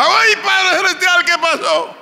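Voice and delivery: high, startled cry of fright; frightened cry. Acted. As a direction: gasp it out as if seeing the dead walk. Dead man's relative sees him return.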